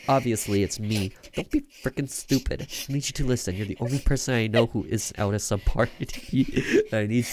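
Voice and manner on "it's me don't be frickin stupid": normal talking voice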